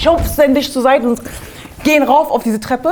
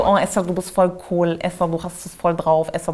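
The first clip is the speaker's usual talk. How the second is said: soft, low, awkward self-praise; a quiet mocking affirmation to herself; 6 semitones lower, 6 dB quieter than usual. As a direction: cringe through praising yourself.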